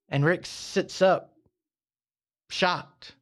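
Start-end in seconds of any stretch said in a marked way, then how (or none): none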